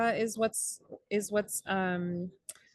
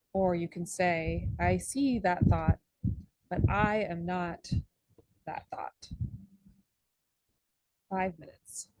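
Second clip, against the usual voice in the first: guided meditation. Acted.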